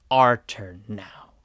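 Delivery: slow, ominous, put-on voice; quoting Harley. Mimicking Harley Quinn's speech to Batman, slow ominous voice. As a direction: drag out her threat darkly. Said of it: "she says".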